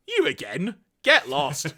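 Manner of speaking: scornful, brushing him off; a hint of an irritated tyrant; dismissive Galvatron. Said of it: "Galvatron responds with".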